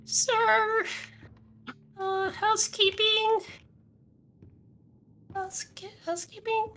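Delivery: high, slow pleading maid voice; housekeeping call. Pretend. Housekeeping character calling again.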